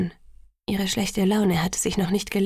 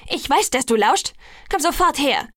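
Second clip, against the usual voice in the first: angry, higher-pitched summons; shouting; 5 semitones higher, 4 dB louder than usual. A narrator's reading. Bella orders her eavesdropping fiancé over, shouting. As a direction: call out as a sharp order. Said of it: "schrie ich".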